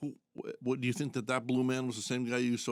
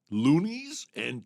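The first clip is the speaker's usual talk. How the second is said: slow and drawn out; 4 semitones higher, 5 dB louder, much slower than usual. Pretend. Drawn-out money name.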